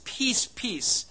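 "peace" doubled for emphasis; emphatic repetition. Shown as a demonstration. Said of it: "They talk about an emphatic peace".

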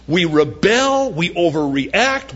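ordinary talk, nothing marked

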